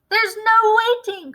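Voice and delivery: impatient cry. A narrator's reading. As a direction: cut him off with a cry. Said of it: "cried Mister Cranky".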